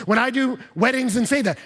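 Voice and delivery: ordinary talk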